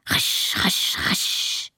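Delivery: breathy swishing broom sound; rhythmic broom swish, unvoiced; broom swish noise.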